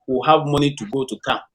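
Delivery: normal talking voice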